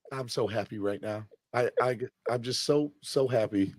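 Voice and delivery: almost breathless happiness; overwhelmed hush. Real, not acted. Overwhelmed fan after trade.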